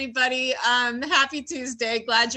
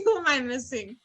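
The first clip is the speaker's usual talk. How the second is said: normal talking voice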